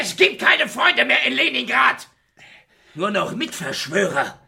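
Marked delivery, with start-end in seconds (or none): 0.0-2.1 high shouted refusal; angry shout. Acted. Refusing the begging partner, shouting in bitter anger. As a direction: throw his friendship back at him. Refusing friend's plea angrily.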